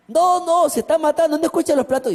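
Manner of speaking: high, urgent neighbour voice; insist, alarmed, that they are killing each other; panicked insistence. Retold or imitated.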